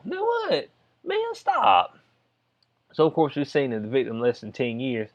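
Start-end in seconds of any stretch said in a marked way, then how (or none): 0.0-2.0 high-pitched, drawn-up squeal of disbelief; incredulous. Acted. Vlogger reacting to parents' claim, incredulous.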